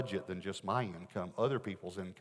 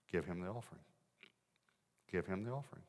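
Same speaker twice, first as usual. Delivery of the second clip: quiet insistent command; 2 semitones lower, 7 dB quieter than usual. Retold or imitated. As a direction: press it gently each time. God's voice repeats command.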